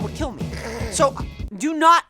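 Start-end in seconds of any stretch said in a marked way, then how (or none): none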